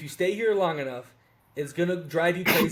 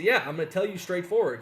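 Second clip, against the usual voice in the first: normal talking voice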